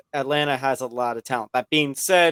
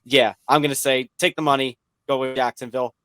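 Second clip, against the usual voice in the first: ordinary talk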